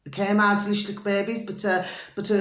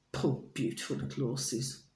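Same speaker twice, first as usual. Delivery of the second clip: lower, much softer, pitying sigh over the horses; 5 semitones lower, 11 dB quieter than usual. Real, not acted. Vlogger grieving horse meat scandal, soft pitying voice. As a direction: mourn the poor little horses softly.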